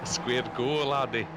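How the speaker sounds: low-pitched put-on Scottish accent; low, teasing imitation of a Scotsman; mock Scottish accent. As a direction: play along in a jokey Scots voice. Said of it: "best Scottish accent, or Glasgow accent".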